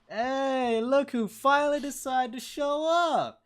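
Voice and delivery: high, loud welcoming call; high loud welcome to a returning friend; cheery greeting. Acted. NPC greets Anson at mansion.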